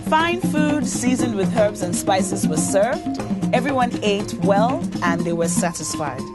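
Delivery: ordinary delivery, nothing marked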